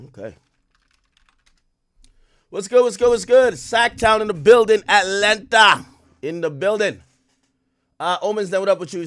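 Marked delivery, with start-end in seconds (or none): 2.5-9.1 loud, high greeting to the crowd; hyped shout-out. Real, not acted. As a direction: call out the cities like a hype man. Hyped shout-outs greeting stream viewers.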